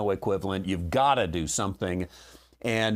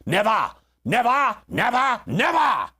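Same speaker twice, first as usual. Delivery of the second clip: high, loud, ringing oratory of Churchill, never repeated; Winston Churchill; 12 semitones higher, 6 dB louder than usual. Shown as a demonstration. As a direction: declaim each never like a wartime speech. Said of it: "let's put on our Winston Churchill".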